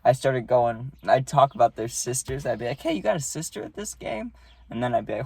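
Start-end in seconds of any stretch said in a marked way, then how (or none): 2.8-4.3 high, light taunt at a batter; mock heckling. Catcher teasing an opposing batter about family, mock heckling. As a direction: pitch it up softly, needling him.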